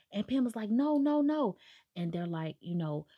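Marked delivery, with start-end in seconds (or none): 0.7-1.6 high, insistent string of refusals; acted protest. Retold captive contradicting her abductors, pleading protest. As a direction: plead in a raised voice, lightly acted.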